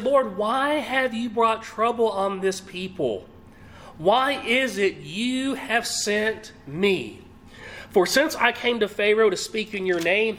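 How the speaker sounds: very slow, weighty reading of the verse; solemn slow pulpit reading; scripture reading. Acted. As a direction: draw out each question as scripture. Reads Moses' prayer slowly.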